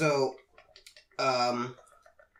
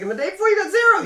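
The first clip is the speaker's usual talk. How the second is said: very high, fast, loud comeback; hammed-up outraged boss; mock retort; 9 dB louder, much faster than usual. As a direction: fire it back squeaking. Manager retorts about zero stats.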